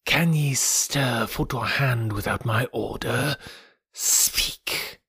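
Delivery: slow, hushed python voice; Kaa. A narrator's reading. Python demanding the monkeys' answer, slow and commanding. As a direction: draw it out like a hypnotizing snake. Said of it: "said the voice of Kaa".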